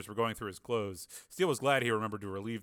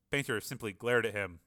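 usual speaking voice

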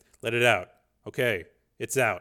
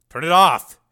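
much louder, higher repeat; panicked shout; 7 semitones higher, 14 dB louder, slower than usual. A narrator's reading. Dev panicking at the computer, shouting. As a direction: scream it.

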